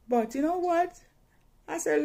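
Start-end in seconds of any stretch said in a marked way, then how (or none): none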